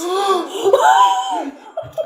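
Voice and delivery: high, loud squealing gasp; excited gasp. Acted. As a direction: gasp with delight. Elf gasps in joy.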